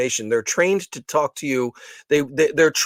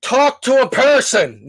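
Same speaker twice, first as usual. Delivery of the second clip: high, slow, loud demand to the phone; exasperated shout at the phone menu; louder call; 9 semitones higher, 4 dB louder, slower than usual. Retold or imitated. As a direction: stretch it out and push it louder. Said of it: "it just gets louder".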